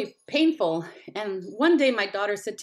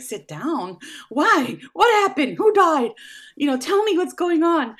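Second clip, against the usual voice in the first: panicked high questions, rising louder; frantic, thinking someone died; alarmed sister demanding what happened; 9 semitones higher, 6 dB louder than usual. Retold or imitated. Retelling panicked reaction to sister.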